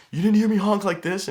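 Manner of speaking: high furious shouted complaint; angry yell. Retold or imitated. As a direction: yell it at full force, outraged. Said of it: "he was like".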